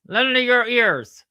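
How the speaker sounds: loud, high, slow declamation; mock oratory. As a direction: declaim grandly to a crowd, drawing it out. Puppet mock-declaims a famous speech.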